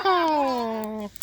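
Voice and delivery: a high whooping cowboy yell; gleeful yeehaw. Real, not acted. Cheering by the fire with family, a high yeehaw whoop. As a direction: whoop it out to the campsite, thrilled.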